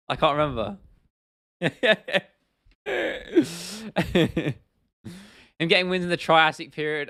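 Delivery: normal talking voice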